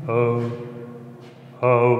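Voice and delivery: calling out to hear the echo; resonant echo. Real, not acted. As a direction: voice ringing in a stone chamber. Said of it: "Comment ça résonne ici?".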